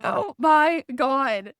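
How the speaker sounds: slow, high, dismayed oh my God; drawn-out reenacted dismay; horrified. She sees her dead pet bunny held by the ears, slow, high and aghast. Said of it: "And I'm just like".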